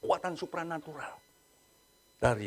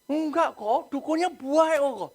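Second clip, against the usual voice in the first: higher, lightly voiced younger sister insisting; sister defending shaman; 10 semitones higher, slower than usual. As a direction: play the naive sister brushing off the worry.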